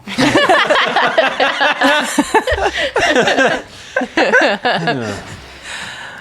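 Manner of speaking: high loud sustained laughter; long laugh. Real, not acted. GM can't stop laughing at the lawman, long loud laugh. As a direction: crack up for a while.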